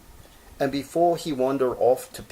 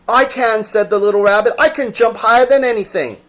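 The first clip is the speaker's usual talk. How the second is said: high, loud, fast protest; indignant little rabbit; 11 semitones higher, 12 dB louder, faster than usual. Acted. Toy rabbit insisting he can jump, high and loud. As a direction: insist hard.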